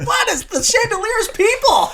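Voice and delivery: high, squeaky shocked question; baffled disbelief. Retold or imitated. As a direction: leap up high in stunned confusion. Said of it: "I'm like".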